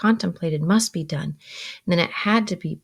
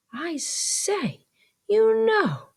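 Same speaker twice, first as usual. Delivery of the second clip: a light high-pitched Joe voice, mildly muttered; Joe muttering; 13 semitones higher, much slower than usual. A narrator's reading. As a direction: mutter it like a worried simple man. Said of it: "muttered Joe".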